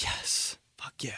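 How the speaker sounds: hushed little yes, fuck yeah; whispered yes. Retold or imitated. Kid whispering his delight to himself. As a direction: whisper it like a secret victory. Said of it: "I'd be like".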